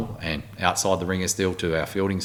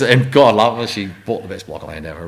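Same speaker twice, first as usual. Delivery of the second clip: ordinary talk, nothing marked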